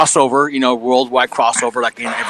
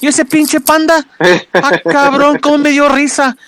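much higher-pitched impression of an amused dad; dad's voice; 13 semitones higher, 4 dB louder than usual. Son quoting his dad's phone call about the panda, high amused voice. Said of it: "my dad calls me and goes".

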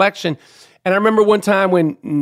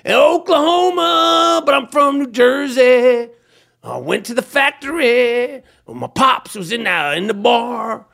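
higher, slow, drawn-out rocker voice; 5 semitones higher than usual. Retold or imitated. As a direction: stretch the words like a rock singer. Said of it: "that's been my take on [name]".

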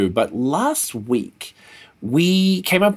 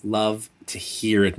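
ordinary talk, nothing marked

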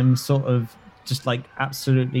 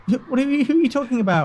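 high, flustered stammering question; panicked boy; 9 semitones higher, much faster than usual. Acted. Boy held by a stranger, high panicked confusion. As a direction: scramble for words in a panic.

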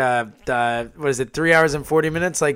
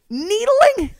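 high, outraged echo; incredulous. Real, not acted. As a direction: repeat it in mock disbelief. Indignant echo of the word.

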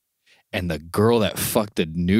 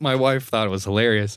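ordinary talk

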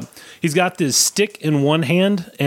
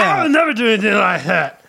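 Bobcat Goldthwait impression; 6 semitones higher than usual. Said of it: "This is Bobcat Goldthwait".

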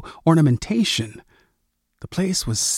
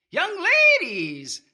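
put-on shrill cracked old man; 4 dB quieter than usual. A narrator's reading. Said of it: "in a shrill, cracked voice".

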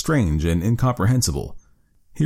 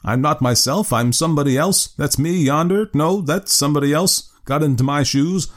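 higher, bewildered exclamation; at his wits' end; 6 semitones higher than usual. Acted. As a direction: blurt it out, utterly confused. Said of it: "exclaimed he at his wits' end".

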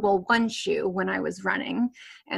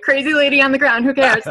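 dismissive; 6 semitones higher, 10 dB louder than usual. Retold or imitated. Voicing dismissive passersby.

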